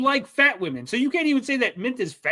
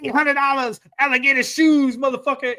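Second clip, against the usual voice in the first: higher, louder mock wrestler boast; Ric Flair brag; 5 semitones higher, 4 dB louder than usual. [name] bragging about his shoes, hammed-up Ric Flair boast. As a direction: hype up the shoes like a swaggering champ. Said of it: "He's doing a Ric Flair".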